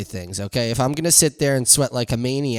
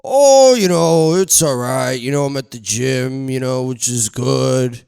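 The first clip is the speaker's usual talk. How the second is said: mock gym-goer; 5 dB louder than usual. Mocking a sauna regular's small talk, loud and hammy. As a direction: overplay the smug gym answer.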